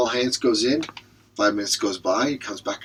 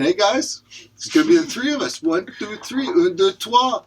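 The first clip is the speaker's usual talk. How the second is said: higher, louder hyped counting; eager count-off; 8 semitones higher, 4 dB louder than usual. Acted. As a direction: psych the group up.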